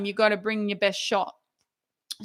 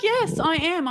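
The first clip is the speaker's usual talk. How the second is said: much higher-pitched acted answer; imitated emphatic confirmation; 8 semitones higher, slower than usual. Senior doctor confirming it's the right form, high and insistent.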